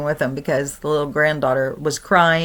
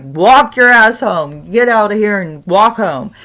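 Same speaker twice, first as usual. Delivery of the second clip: gruff order; 4 semitones higher, 6 dB louder than usual. Retold or imitated. Grandpa telling the ghost to walk home, gruff and loud. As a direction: lay down the law.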